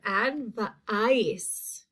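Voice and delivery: "advice" broken up, ad-vuh-ICE; long I sound. Shown as a demonstration. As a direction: stretch the long I in the second part of "advice". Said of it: "Second syllable".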